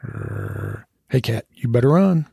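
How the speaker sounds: dog sizing up a cat. Acted. Maggie warning off the orange cat, mock threat. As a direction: growl first, then warn off the cat. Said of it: "Maggie and the cat exchanged telepathic remarks".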